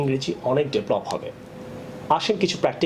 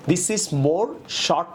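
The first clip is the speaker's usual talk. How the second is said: slow demo of wrong sentence; 3 semitones higher, much slower than usual. Shown as a demonstration. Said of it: "না হয়ে হবে".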